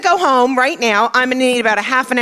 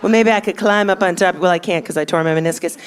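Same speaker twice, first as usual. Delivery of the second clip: phone sex operator. Retold or imitated. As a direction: play a tired middle-aged phone sex line, mildly. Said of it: "she's a phone sex operator".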